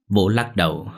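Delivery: ordinary delivery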